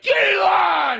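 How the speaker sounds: high screamed order; screaming TSA agent. Retold or imitated. Airport worker snapping at the mooing traveler, screaming. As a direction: shriek the order at the line. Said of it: "She's like".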